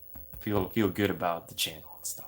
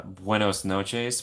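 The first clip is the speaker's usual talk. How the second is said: slow careful Spanish; much slower than usual. Shown as a demonstration.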